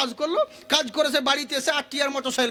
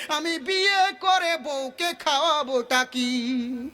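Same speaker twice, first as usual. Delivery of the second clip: higher, intoned plea with stretched endings; melodic preaching; 4 semitones higher, slower than usual. Acted. Poor companion pleading to the Prophet, intoned lament. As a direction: chant the poor man's complaint.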